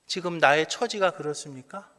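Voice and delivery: ordinary delivery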